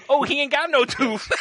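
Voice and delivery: high loud laughing exclaim; laughing realization. Retold or imitated. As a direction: crack up at the discovery. Realizing the tooth is gone.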